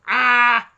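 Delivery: loud burst of glee; loud joyful shout. Real, not acted. Player overjoyed by the date, loud shout. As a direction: shout for joy.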